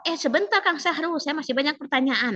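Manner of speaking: an examiner holding the floor, mock-brisk; examiner cutting in. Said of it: "perhatikan intonasi penguji".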